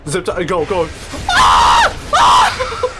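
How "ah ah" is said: high laughing screams of no; screaming laughter. Real, not acted. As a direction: shriek and laugh at once. Getting killed, screaming laughing.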